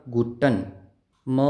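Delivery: usual speaking voice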